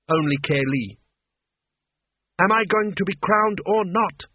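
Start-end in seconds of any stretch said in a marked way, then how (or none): 2.4-4.2 freeze them with the question; icy. A narrator's reading. Kaylee to the others, icy demand. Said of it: "she said icily".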